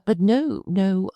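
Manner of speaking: ordinary talk, nothing marked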